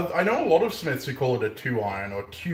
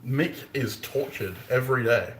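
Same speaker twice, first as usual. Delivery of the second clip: ordinary talk, nothing marked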